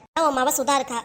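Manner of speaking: normal talking voice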